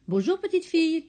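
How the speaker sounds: petite voix d'oiseau aiguë et plus forte; gazouille-le comme un oiseau perché. A narrator's reading. Un oiseau parle. (un oiseau salue la fillette, voix aiguë et chantante)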